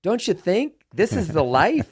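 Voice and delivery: drawn-out, high and loud, slow; slow, high, loud pleading delight; retold gushing question. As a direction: stretch it out, begging her to agree. Pressing wife for agreement.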